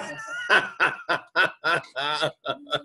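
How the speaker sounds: long, amused laugh; real laugh. Real, not acted. Teacher amused by own follow-up question, laughing. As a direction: laugh it out after the tease.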